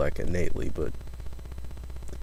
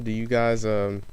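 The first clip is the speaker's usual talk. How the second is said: usual speaking voice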